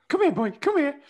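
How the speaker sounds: high, loud excited call to a dog. Acted. Podcaster shows how to call a dog, high and encouraging. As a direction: call the dog over, pitched right up. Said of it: "it's the tone and stuff".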